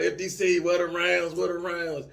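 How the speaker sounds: high, loud impatient call across the gun line; chief calling out. Retold or imitated. Gun line chief calling for rounds, impatient loud call. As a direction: bark it like an impatient section chief. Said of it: "gun line chief calling".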